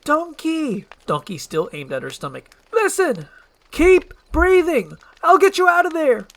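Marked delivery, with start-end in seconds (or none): 0.0-0.8 high, loud protest; indignant high-pitched shout; exasperated cry. Acted. Princess protests. 2.7-6.3 high, loud hollering instructions; urgent yelling, stretching the words; frantic rescue shout. Acted. Shouts rescue promise into stomach.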